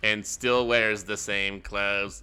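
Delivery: loud, slow comic robot. Acted. Chatbot mocking the billionaire's wardrobe, slow robot voice. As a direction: drag it out like a stilted machine. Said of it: "talk in the robot voice".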